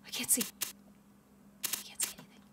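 hushed whisper while hiding. Real, not acted. Player hiding in the dark, whispering. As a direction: breathe the words so no one hears.

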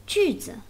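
sharply falling 句, then light, low 子; fourth tone, low neutral. Shown as a demonstration. Teacher showing neutral tone after fourth, 句子 falling to low. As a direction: say 句子 with 句 falling and 子 dropped to the bottom. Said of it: "then the neutral tone is the lowest one".

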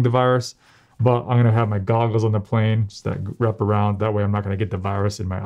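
muffled behind fabric mask; through a face mask. Real, not acted. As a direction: mouth covered by mask. Seen 3: Mask worn over nose and mouth throughout; removed by 18.7.

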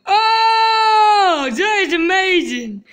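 loud thrilled cry of delight; excited shout. Real, not acted. Thrilled on the slide.

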